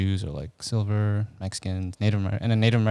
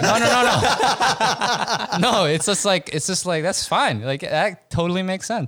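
mock reassurance; 8 semitones higher, 5 dB louder than usual.

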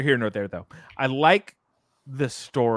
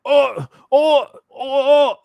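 put-on high, loud Australian accent shout; 12 semitones higher, 9 dB louder, slower than usual. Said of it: "That's my Australian accent".

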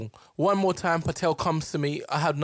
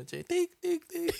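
high beeping scanner sound; 4 dB quieter, slower than usual. Retold or imitated. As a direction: beep like a till scanner. Imitating scanner beep.

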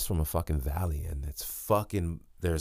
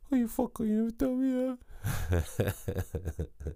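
very high falsetto mock complaint; silly imitation of a scolding partner; Chelsea impression; 3 dB quieter than usual. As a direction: whine it in a put-on falsetto. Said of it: "That's how she talks".

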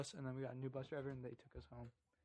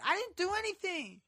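much higher whiny protest; mock whiny driver; 14 semitones higher, 5 dB louder than usual. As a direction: play the driver's defensive high whine. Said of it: "And she's like".

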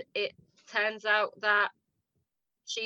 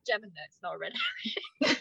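dismissive; 3 semitones lower, 4 dB quieter, much faster than usual. Retold or imitated. Mum's retold dampening reply.

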